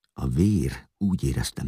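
plain reading voice

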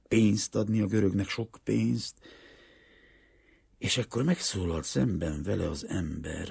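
usual speaking voice

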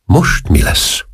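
slow, voiceless, dismayed question; elsápadt rémület. A narrator's reading. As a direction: ask it aghast, slowly and almost whispered. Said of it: "kérdezte az őrnagy elsápadva". (elhaló, rémült, lassú kérdés)

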